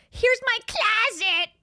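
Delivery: mocking an influencer's fake Boston drawl; mocking her fake Boston accent. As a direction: drag out a mock Boston accent. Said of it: "that's a bad accent".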